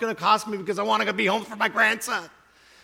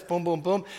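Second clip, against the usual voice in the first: ordinary delivery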